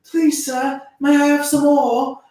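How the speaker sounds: high, timid orphan voice; pleading orphan. Retold or imitated. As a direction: beg like a hungry orphan. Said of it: "he's like".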